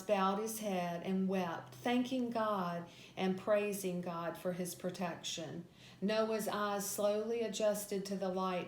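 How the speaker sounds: ordinary delivery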